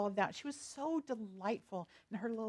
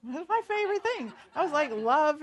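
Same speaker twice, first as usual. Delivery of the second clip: laughing; 5 semitones higher, 7 dB louder than usual. Real, not acted. Laughing over chocolate samples.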